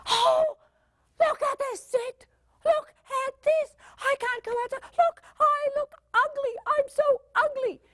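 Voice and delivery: put-on high-pitched, over-the-top teen wail; very high, stretched-out mock whining; dramatic teenager. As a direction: play a teenager in hammed-up despair. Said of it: "a teenager will come up and".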